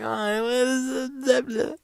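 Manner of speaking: high, drawn-out mock wail; mock crying. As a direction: imitate a sobbing girl in gibberish. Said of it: "the girl's sad and crying".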